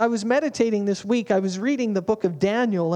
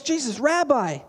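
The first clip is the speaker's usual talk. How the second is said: higher mock-eager voice, leaning on so lucky; overeager Peter; 5 semitones higher than usual. Peter tells Jesus how lucky he is, higher and mock eager. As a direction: play Peter puffed up and pleased with himself. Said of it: "he goes".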